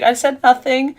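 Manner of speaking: put-on high, loud little-kid denial; denying kid. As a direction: insist like a caught child. Kid denying to her father.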